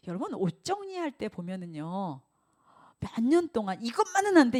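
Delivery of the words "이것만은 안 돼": high, loud mock protest; 집착하는 흉내. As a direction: refuse like a clinging hoarder. 옷을 못 버리는 사람 흉내. (옷을 버리지 못하는 사람의 높은 항의 흉내)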